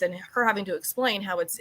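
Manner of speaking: usual speaking voice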